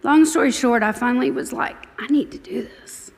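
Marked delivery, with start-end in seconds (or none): 2.0-3.1 whispered self-talk; whispered resolve. Retold or imitated. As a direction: murmur it as a private decision. Inner resolve, quoted thought.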